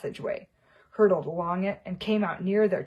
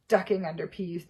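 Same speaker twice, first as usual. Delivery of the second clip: ordinary delivery, nothing marked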